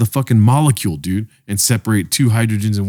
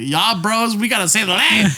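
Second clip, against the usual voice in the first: higher mock dictator voice, hammed-up; a higher, silly rally-speech voice; mock Hitler; 9 semitones higher than usual. Mocking Hitler for a friend on the podcast, higher jokey voice. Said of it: "I think Hitler used it a lot".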